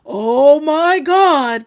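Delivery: loud, slow, stretched "Oh my God"; Fluff's catchphrase. Retold or imitated. Describing Fluff's catchphrase to the chat in a loud, stretched "Oh my God". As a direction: drag it out, loud and amazed. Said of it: "where Fluff goes".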